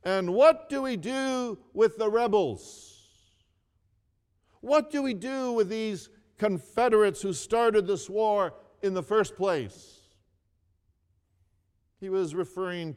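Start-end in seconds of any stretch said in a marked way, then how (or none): 0.0-10.0 loud, higher demanding shout; shouted question. Retold or imitated. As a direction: call out the question at the speaker. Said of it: "He shouted out".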